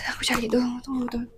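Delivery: breathy whisper while flipping pages; whispering to herself, looking for the passage. Real, not acted. Whispers to herself while searching the book for a quote.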